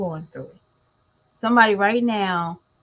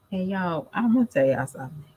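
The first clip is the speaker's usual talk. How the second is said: normal talking voice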